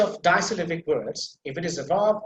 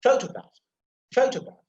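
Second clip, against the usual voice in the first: Photograph with a high, punched PHO; emphasis on first syllable; 7 semitones higher, 3 dB louder than usual. Shown as a demonstration. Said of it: "first syllable that I'm putting emphasis there".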